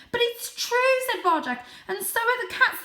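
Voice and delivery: high, pleading kitten voice; insistent kitten. Acted. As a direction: insist desperately.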